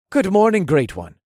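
high, loud, chirpy morning hello; chipper greeting. A narrator's reading. Lieutenant greeting his leader in the morning, chipper. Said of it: "the lieutenant seemed so chipper".

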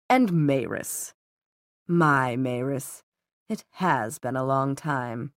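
slow, low, savoring villain; smooth villain. Acted. Valentine greeting Maris after a long absence, slow and smooth.

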